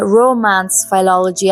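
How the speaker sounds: normal talking voice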